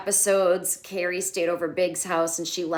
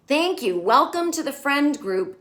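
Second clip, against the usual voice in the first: delighted cheer; 7 semitones higher, 4 dB louder than usual. Real, not acted. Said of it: "it made me so happy".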